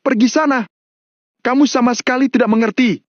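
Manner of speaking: high-pitched furious dismissal; acted yell at normal volume; angry shout. A narrator's reading. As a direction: send him away in a heated high voice. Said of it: "Mario terengah-engah sambil berteriak".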